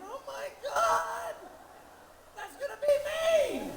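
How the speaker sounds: high, slow wail of dread, half laughing; slow, high, laughing cry of horror; mock horror. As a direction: howl it like a doomed man. Horrified at his coming fate.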